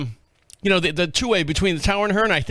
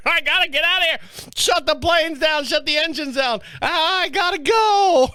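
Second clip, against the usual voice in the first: higher, half-shouted, dragged out at the end; frantic pilot yelling; 8 semitones higher than usual. Retold or imitated. Tripping pilot demanding the engines be shut down, mock frantic yell. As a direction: plead to get out, stretching the last words. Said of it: "he's beating on the door".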